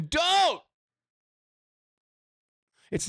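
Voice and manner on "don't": "Don't!" jumps way up in pitch like a sudden cry; a high, startled yelp of refusal; mock scream. Life screaming at you, a high mock "Don't!". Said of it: "everything around you is screaming".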